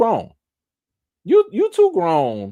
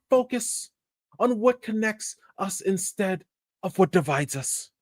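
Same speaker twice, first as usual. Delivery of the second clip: "past" as /pɑst/, a lightly put-on British accent, higher; mock British accent; 10 semitones higher than usual. Vlogger mocking the actress's statement in a bad British accent. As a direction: read her statement in a hammed-up British accent. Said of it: "she does have a certain accent. Let me get that".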